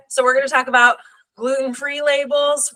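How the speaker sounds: ordinary talk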